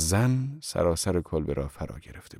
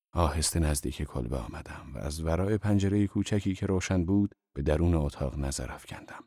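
plain reading voice

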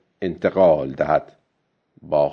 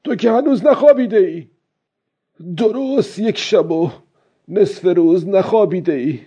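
put-on high, raised old fisherman's voice, easing toward the end; loud self-talk; 6 semitones higher than usual. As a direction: call out to yourself, urging and scolding. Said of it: "بعد با صدای بلند گفت". (با صدای بلند، خطاب به خود)

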